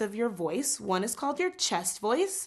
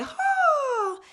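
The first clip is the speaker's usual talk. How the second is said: very high, louder "Ah"; head voice ah; 5 dB louder than usual. Shown as a demonstration. Teacher shows students head-voice resonance. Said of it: "you say".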